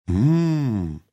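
loud startled mumble of fear; old man's scared mumble; frightened hum. Acted. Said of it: "сказал дед и сам перепугался".

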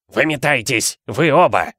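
strained, croaked order; Uncle Vernon croaking. A narrator's reading. Uncle throwing both boys out, mild hoarse command. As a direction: force the order out in shock. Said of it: "прохрипел дядя Вернон".

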